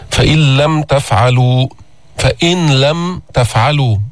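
فإن لم تفعلوا with the نون merged into a doubled لام, slow; recited with assimilation. Shown as a demonstration. Teacher reciting the example for students, slow and measured. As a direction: chant it slowly, no نون heard before لم. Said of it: "كيف لفظناها؟".

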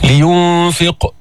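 لينفق slow, with a bouncing echo on the final qaf; qalqala. Shown as a demonstration. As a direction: release the heavy q of لينفق with a small pop. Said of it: "جيب القلقلة،".

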